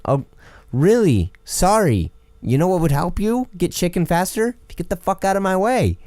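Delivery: high mocking sarcasm; sarcastic retort. To a pushy customer, high sarcastic sneer. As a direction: sneer it sweetly, then snap.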